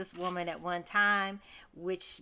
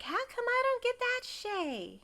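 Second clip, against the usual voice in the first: whiny Miranda; 13 semitones higher than usual. Retold or imitated. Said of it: "Miranda's mad".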